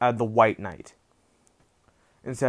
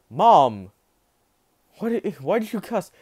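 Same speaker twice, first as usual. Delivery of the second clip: put-on shocked child; 7 semitones higher, 4 dB louder than usual. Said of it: "Eri's shocked".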